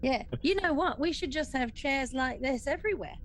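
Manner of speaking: normal talking voice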